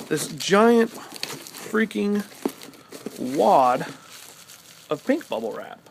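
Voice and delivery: very slow, stretched-out disbelief; exasperated drag. Retold or imitated. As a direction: spell out the absurd wad slowly. Exasperated unwrapping retold.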